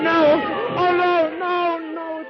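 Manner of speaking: high, loud cry of terror; panicked cry. Acted. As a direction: wake in horror after the crash. Man wakes after train wreck.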